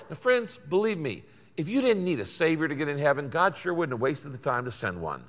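ordinary talk, nothing marked

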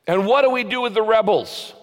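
high, loud shout from a crowd; shouted question. Retold or imitated. A senator shouting to Lincoln from the crowd, loud and high. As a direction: call it out over the crowd. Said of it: "shouted out".

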